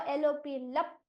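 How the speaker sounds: ordinary talk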